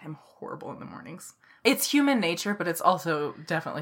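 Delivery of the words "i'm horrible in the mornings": whispered confession, voice dropped right down; sheepish whisper. Real, not acted. Confessing her own morning grumpiness to her friend, whispered. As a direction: admit it under your breath, guilty.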